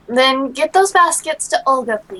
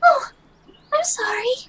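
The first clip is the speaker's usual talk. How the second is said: put-on a flustered girl, much higher; embarrassed girl; 13 semitones higher than usual. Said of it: "Lena blushed".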